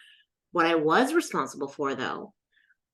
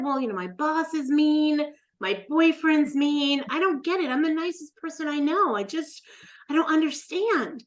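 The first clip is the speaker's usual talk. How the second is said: high-pitched, baffled protest; plaintive self-defense; 8 semitones higher than usual. Retold or imitated. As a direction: plead innocence in a raised pitch, acting out a past self. Retold complaint to the healer.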